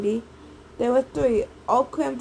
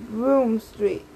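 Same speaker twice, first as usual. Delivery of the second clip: ordinary delivery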